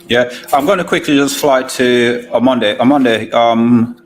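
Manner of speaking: booming, over-loud on the mic; suddenly loud. Real, not acted. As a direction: blare it out as if the mic just got turned up. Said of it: "You went all loud then".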